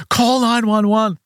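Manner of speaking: high, slow urgent call; calls for help over the fire. Retold or imitated. Man fighting the fire, calling for help: slow high urgent call.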